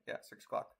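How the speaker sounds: whispered self-check under the breath; muttering to himself. Real, not acted. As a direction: mumble it quietly to yourself. Checking the time under his breath.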